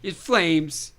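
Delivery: mock drama, rising high; hammed-up film quote. Co-host riffs on his hair, hammed-up quote. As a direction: ham it up, pitch climbing.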